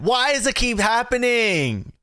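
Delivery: high, slow, drawn-out Aussie vowels; Aussie twang creeping in. Real, not acted. Host frustrated that his Australian accent keeps slipping out. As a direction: let the Australian accent leak through, laughing at yourself. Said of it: "I can't not say it like that".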